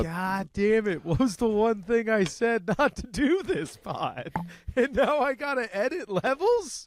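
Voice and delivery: real exasperation, voice jumping high; fed up over the audio levels; exasperated. Real, not acted. Cohost messed up audio levels.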